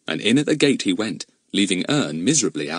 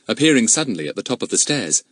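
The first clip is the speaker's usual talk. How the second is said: ordinary delivery, nothing marked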